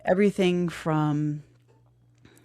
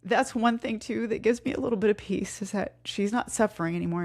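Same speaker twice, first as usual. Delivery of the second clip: getting emotional; 5 semitones higher than usual. Real, not acted. To listeners about her late mom, holding back tears. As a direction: voice lifting and thinning as tears come. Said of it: "I might get a little emotional".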